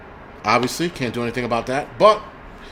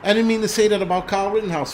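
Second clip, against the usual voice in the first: mock pleading; 7 semitones higher than usual. Streamer mocks begging an unsubscriber to return, fake pleading. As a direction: plead in a raised pitch, hammed-up and insincere. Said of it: "he thought I was gonna be like".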